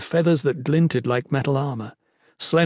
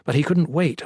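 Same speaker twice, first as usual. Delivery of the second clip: ordinary delivery, nothing marked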